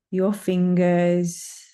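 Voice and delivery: ordinary talk, nothing marked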